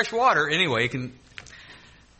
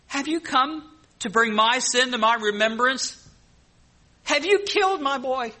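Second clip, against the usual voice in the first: anguished widow; 9 semitones higher, 3 dB louder than usual. Acted. Grieving widow accuses Elijah.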